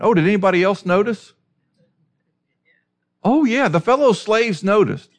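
high, fast rhetorical exclamation; spring the question on the crowd; amused emphasis. Real, not acted. Preacher teasing congregation about the onlookers, high and amused.

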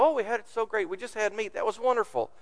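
high, mock-wistful grumble; whiny complaining. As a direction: play the spoiled complainer. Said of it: "complaining about".

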